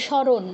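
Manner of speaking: স্মরণ as shoron, m silent; Bengali pronunciation. Shown as a demonstration. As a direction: drop the m in স্মরণ. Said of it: "উচ্চারণ কি করি?".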